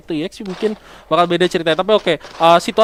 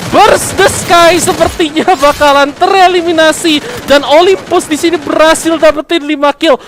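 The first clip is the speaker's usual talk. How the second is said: excited; 8 semitones higher, 6 dB louder than usual. Real, not acted. Caster calls team wipe.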